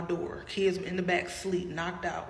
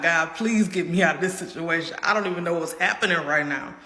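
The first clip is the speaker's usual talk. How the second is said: desperate prayer; 8 dB louder than usual. Retold or imitated. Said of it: "I'm praying to God".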